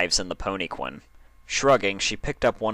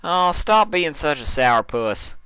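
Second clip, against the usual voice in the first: higher, louder, slow smiling tease; 8 semitones higher, 5 dB louder than usual. Acted. Applejack teasing sulking Dash, smiling. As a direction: tease her out of the sulk with a smile. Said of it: "smiling that infectious smile of hers".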